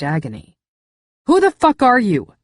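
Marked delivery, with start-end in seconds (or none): 1.3-2.3 loud, high furious demand; angry snap. Acted. Narrator snaps at the injured intruder on the sidewalk, furious shout. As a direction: snap it at him hard. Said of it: "I snapped at him".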